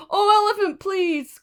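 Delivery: high, loud begging birds; pleading cry. Acted. Birds begging the elephant, high loud plea. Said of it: "cried the birds in the trees".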